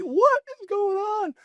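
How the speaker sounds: very high drawn-out mock scream; hammed-up high-pitched freak-out; retold losing it. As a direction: stretch it out in a squeaky panic. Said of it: "I'm, like, losing my mind".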